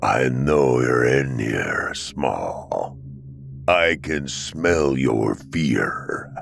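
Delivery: low, slow, growling beast; deep growling monster voice. Acted. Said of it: "a low growl and a deep-set voice say".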